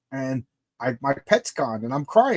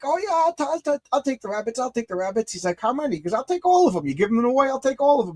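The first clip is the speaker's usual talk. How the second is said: high, excited voice grabbing the offer; eager acceptance; 8 semitones higher than usual. Retold or imitated. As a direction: jump at the offer like a hungry man. Coworker eagerly accepting the rabbits.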